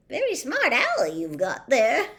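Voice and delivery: lightly voiced chuckling barman; friendly landlord with a chuckle in his voice. A narrator's reading. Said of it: "chuckled Tom".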